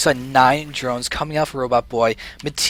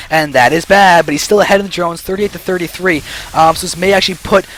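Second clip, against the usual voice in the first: ordinary delivery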